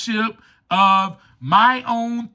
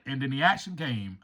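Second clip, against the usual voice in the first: ordinary talk, nothing marked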